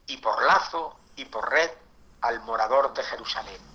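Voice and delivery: slow, weighty reading of the verse; solemn scripture reading. Acted. Preacher reads Isaiah to the congregation, solemn and weighty. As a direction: read the prophecy like a pulpit proclamation.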